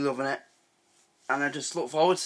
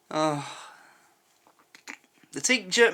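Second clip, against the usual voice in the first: ordinary talk, nothing marked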